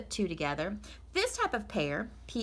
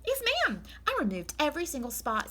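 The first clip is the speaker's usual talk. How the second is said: Amelia Bedelia; 8 semitones higher than usual. Acted. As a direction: earnestly promise the master. Amelia proudly explains.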